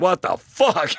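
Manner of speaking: high-pitched mock disbelief; retold astonishment. Man hearing a parrot talk back, mock astonishment. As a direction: act out being floored, pitch jumping up. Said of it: "And he's like".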